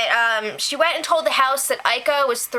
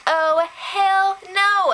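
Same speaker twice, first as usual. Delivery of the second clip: high, slow, drawn-out sass; mock indignant refusal; 8 semitones higher, much slower than usual. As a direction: imitate an indignant comeback, stretching every word. Retold indignant comeback in a quarrel.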